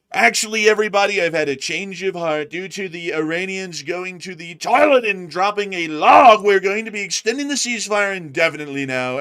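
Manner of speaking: high, loud pompous announcer; grandstanding official backing down; mock announcement. US leader announcing a ceasefire in a loud pompous mock voice. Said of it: "it comes to the very last hour of that deadline".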